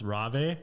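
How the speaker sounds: "Rave" in two syllables, RAH-vay; pronounced Rah-vay. Shown as a demonstration. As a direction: say "Rave" as rah-VAY, with a sounded final vowel. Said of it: "I think it's pronounced".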